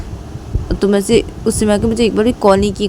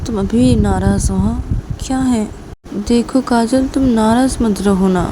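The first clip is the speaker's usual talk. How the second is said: higher, pleading coaxing voice; Reshma coaxing; 3 semitones higher than usual. Acted. Teacher coaxing upset Kajal to look at her, higher pleading voice. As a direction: coax a sulking friend, pitched up. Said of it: "Reshma coffee पीते पीते ऐसे काजल को बोलती कि".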